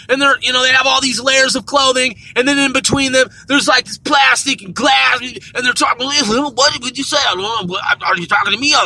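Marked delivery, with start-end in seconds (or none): 6.1-8.7 muffled clerk voice. Retold or imitated. Store clerk behind plastic, muffled.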